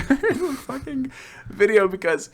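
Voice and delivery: high, squeaky laugh through the words; real giggle breaking into the sentence. Real, not acted. Cracks up at favourite scene.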